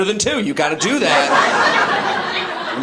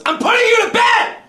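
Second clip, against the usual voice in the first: high, furious scolding shout; mad mama bear; 4 dB louder than usual. Retold or imitated. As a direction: scold like a mother at the end of her rope. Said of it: "you knew she was mad".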